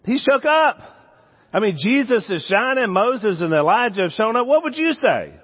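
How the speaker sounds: picture the shining scene and get carried away; excited, worked up. Real, not acted. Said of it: "But he was nervous".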